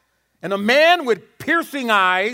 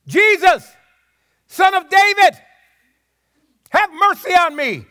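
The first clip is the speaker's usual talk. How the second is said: high, loud pleading cry; 10 semitones higher, 6 dB louder than usual. Retold or imitated. Blind man cries out to Jesus over the crowd, loud pleading. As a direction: call out over the crowd, begging. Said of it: "But he said".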